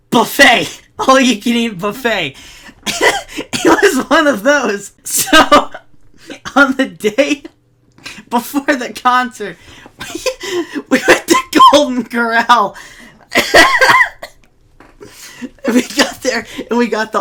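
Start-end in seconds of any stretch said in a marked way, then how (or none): 2.8-3.6 a short squeaky laugh; high burst of laughter. Real, not acted. Laughing at his own story. 13.3-14.3 loud high squeal of laughter. Real, not acted. Laughing at his own story.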